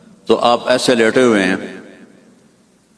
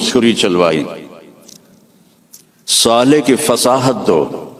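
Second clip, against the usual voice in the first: slow, rising sermon cadence; fervent preacher listing blessings; preaching litany; slower than usual. Acted. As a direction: build each line like a proclamation. Litany of gifts from the unseen voice.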